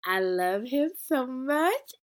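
drawn-out fangirl gush; gushing love. Real, not acted. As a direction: swoon over him. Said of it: "shout out to my favorite character".

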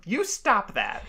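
imitating a cross mom; scolding mother. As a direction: scold like a mother fed up with nonsense. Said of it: "Like a scolding mother".

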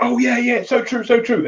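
high, breezy mock agreement. Mimicking readers who nod along then forget, high breezy mock. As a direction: toss it off like a reader nodding along. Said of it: "It's like".

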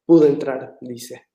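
ordinary delivery

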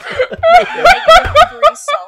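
high, loud shriek of laughter. Real, not acted. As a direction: let out a squealing laugh. Bursts out laughing.

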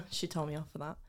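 ordinary talk

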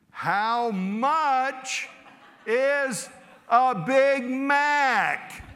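loud, slow, high tourist voice, each word stretched; preacher hams up a tourist shouting at a Portuguese waiter, loud and slow. Retold or imitated. Over-pronounce it loudly as if volume fixes it. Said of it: "I got louder".

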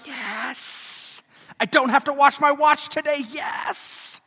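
high, hammed-up celebration with hissed yeses; imitated delighted wife. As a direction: cheer in a high put-on voice. Imagined wife rejoices at short Mass.

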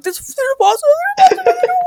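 high-pitched mock crying panic; crying in terror. Child crying to uncle about the possum, high panicked wail. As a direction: imitate your own terrified blubbering, voice shooting way up. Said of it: "fucking crying like a little bitch".

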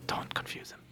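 hushed stage whisper. Real, not acted. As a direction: whisper it behind his back. Shushing a co-host as a joke.

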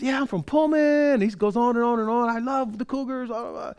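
high, eager mock fan voice; gushing fan. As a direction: imitate a fan going on about the team. Imitating a fan going on.

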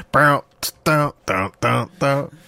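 vocal imitation of punchy band hits; beatbox the movie music with your mouth; mouthed soundtrack riff. Host mimics the Bruce Almighty sequence music, mouthed riff.

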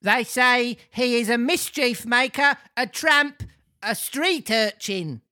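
high character voice, slowing at the end; comic old man. Acted.

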